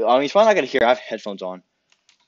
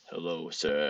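low, soft put-on voice; disguised voice; 11 semitones lower, 10 dB quieter, slower than usual. Shown as a demonstration. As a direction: drop right down for a quiet disguise. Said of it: "That's not different enough".

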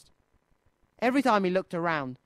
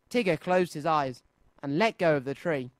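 ordinary delivery

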